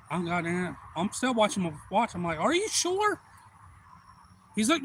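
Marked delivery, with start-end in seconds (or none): 2.4-3.2 pitched way up, doubting question; acted disbelief. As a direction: question it in high mock disbelief. Doubts diver is safe.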